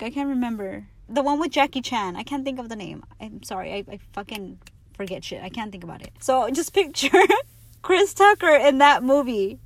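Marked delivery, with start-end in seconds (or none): 6.2-9.6 laughing. Real, not acted.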